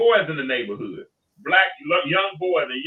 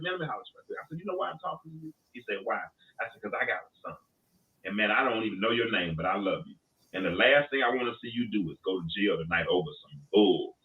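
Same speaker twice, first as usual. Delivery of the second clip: much softer, low and sincere; earnest heart-to-heart; 5 semitones lower, 6 dB quieter than usual. Retold or imitated. Father figure telling the boy he cares, soft and sincere. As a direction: lean in and speak from the heart.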